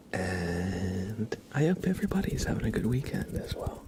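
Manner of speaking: unhurried breathy ASMR murmur; ASMR whisper. Acted. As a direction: whisper gently to the chat like ASMR. ASMR stream intro whispered.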